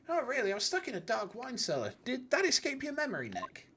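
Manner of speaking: reading game dialogue in character voices; voicing game characters. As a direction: act out each character in turn.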